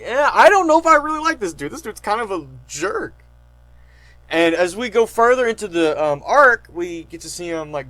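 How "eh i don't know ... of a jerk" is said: skeptical mock reaction. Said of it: "you're like".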